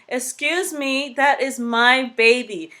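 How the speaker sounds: slow, loud rising anger; indignant mother. Retold or imitated. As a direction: put your foot down over your baby. Mother getting angry.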